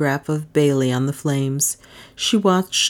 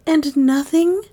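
higher, rising breathed question; 11 semitones higher, 3 dB louder, slower than usual. A narrator's reading. Dale asking Bailey, high and breathless. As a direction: hang on his answer. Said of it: "breathed Dale".

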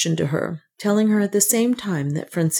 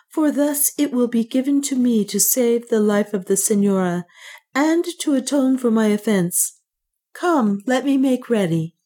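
a hint of a serene young priest; clear priest's acceptance; 7 semitones higher than usual. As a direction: accept calmly like a resolved priest. Francisco the priest speaks.

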